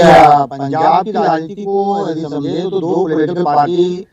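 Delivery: ordinary talk, nothing marked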